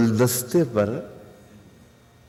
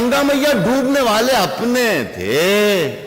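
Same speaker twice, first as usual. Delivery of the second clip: poetry recitation; 8 semitones higher than usual. Acted. Couplet repeated, high.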